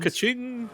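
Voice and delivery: high chirpy ka-ching; cash register ka-ching. Retold or imitated. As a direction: chime it out like a till. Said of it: "it makes a ka-ching sound".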